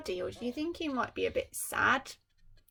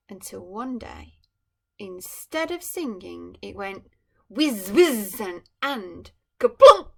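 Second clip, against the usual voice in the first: storybook read growing into a loud kaplunk; dramatic story build-up; 13 dB louder, slower than usual. A narrator's reading. Reading to a child as the toy bird breaks, building to a bang. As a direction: build to a crash.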